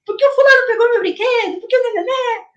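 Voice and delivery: put-on high, fast, childish bickering; mock child. Kids squabbling over a toy, lightly voiced whiny child. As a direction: rattle it off like a squabbling kid.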